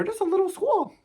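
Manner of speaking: put-on playful baby talk about a tiny school; mock baby voice. Mocking how tiny the school is.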